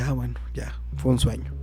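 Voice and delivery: soft unvoiced sigh of relief; relieved whisper. Waking from a nightmare, whispered relief. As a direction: breathe it out in a whisper.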